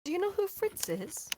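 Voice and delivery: cautious question. Acted. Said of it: "just says through the door".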